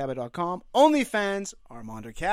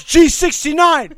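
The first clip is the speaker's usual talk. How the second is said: bingo caller; 6 semitones higher, 14 dB louder, slower than usual. Acted. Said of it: "Bingo caller".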